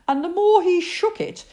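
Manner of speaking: ordinary delivery, nothing marked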